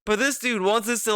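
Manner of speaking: normal talking voice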